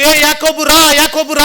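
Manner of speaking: imitating a teacher's loud, high call of a name. The sir calls the student by name, loud and high. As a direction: call the name across the classroom. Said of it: "అంటున్నాడు మా sir".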